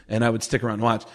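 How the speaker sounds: normal talking voice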